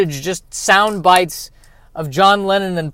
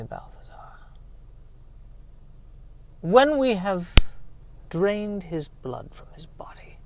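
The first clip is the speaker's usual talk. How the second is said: sinister whisper; 11 dB quieter than usual. Acted. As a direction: savour every word like a vampire king. Villain whispers blood bath.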